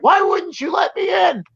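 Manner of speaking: high, shouted mock complaint; whiny angry yell. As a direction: imitate an angry driver yelling. Mimicking angry drivers yelling.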